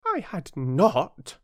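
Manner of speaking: high, slow, emphatic retort; angry. A narrator's reading. Mouse contradicting Alice, angrily. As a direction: contradict it crossly, drawing out the words. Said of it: "cried the mouse angrily".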